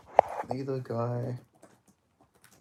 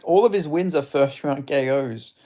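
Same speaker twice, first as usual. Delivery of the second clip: usual speaking voice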